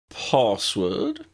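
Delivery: a lightly haughty demand. Acted. The portrait lady challenges the girls, mildly haughty. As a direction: look down your nose at the newcomer. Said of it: "she asked in a haughty tone".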